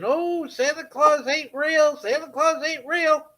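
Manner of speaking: high singsong mockery; lightly voiced taunting kid; mocking taunt. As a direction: tease like a bratty kid. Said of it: "you're running around in a Santa outfit saying".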